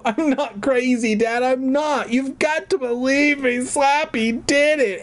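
high-pitched frantic insistence; hammed-up desperate protest; desperate pleading. A narrator's reading. Amy pleads to be believed.

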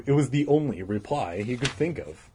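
ordinary delivery, nothing marked

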